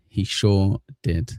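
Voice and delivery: slow, stretched-out words; drawn-out repeat. Real, not acted. Streamer repeating an agreement to the chat, drawn out. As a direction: linger on each word, at half pace.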